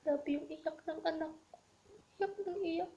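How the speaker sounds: fighting back tears while recounting; tearful retelling; voice breaking. Real, not acted. Retelling child crying at departure.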